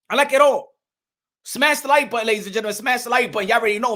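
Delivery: normal talking voice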